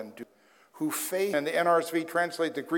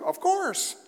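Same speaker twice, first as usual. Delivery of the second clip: a sudden, much higher, sarcastic "of course"; sarcastic aside. Acted. Pastor mocking the pamphlet's easy promise, sarcastic.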